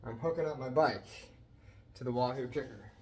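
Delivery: normal talking voice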